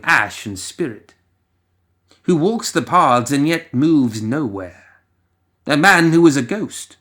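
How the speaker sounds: ordinary delivery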